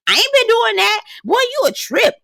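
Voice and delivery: high mocking girl voice, snapping at LaRon; mocking Alexis. Imitating Alexis snapping at LaRon in a high voice.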